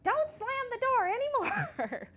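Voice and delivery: high, loud, drawn-out mock yell; mom yelling. Mom reacts to slammed door at home, mock yelling. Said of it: "Mom yelling".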